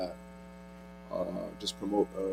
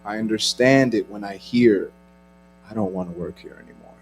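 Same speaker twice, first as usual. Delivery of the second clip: spoken word poetry; 13 dB louder than usual. Acted. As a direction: perform it like a slam poet. Performs spoken word poem.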